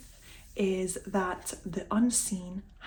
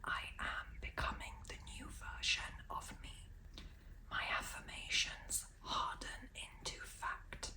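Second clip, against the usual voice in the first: whispered affirmations; 4 semitones higher, 15 dB quieter than usual. Real, not acted. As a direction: whisper each affirmation gently. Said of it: "I'm going to try whispering them".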